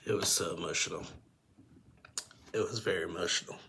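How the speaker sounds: soft, subdued, fighting back real tears; emotional. Real, not acted. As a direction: say it softly after a long pause to regain composure. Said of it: "I get emotional with that".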